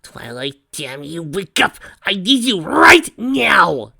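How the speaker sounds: building from plain to a high, slow shout; desperate yelling. Acted. Desperately yelling to wake sleeper.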